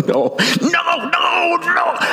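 high-pitched wailed protest, acted out; hammed-up meltdown, crying out each refusal; angry venting. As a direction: wail it like someone at the last straw. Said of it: "I was angry".

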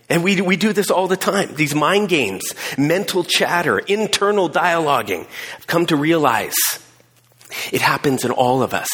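usual speaking voice

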